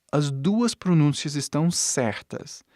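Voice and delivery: ordinary talk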